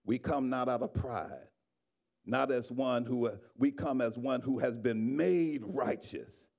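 ordinary delivery

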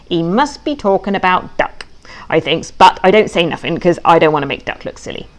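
ordinary delivery, nothing marked

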